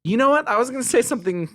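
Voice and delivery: laughing, high and loud. Real, not acted. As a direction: give in through laughter. Laughing acceptance.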